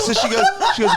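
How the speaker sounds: laughing, voice jumping high; giggling through the words. Real, not acted. Laughing while retelling.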